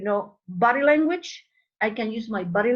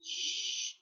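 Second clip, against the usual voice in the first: soft voiceless shush; sustained "sh" hiss; long hushing "shh"; 12 dB quieter than usual. Shown as a demonstration. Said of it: "That's the sound".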